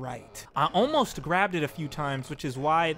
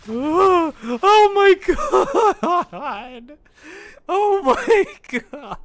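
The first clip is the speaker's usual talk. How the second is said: high, loud laugh-filled cry, stretched slow; laughing disbelief; 12 semitones higher, 11 dB louder, much slower than usual. Real, not acted. Streamer laughing in disbelief after the win. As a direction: laugh it out in shock.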